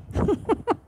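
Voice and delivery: short high-pitched giggle. Real, not acted. Laughing as she unmasks her teasing viewers. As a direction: giggle while teasing friends.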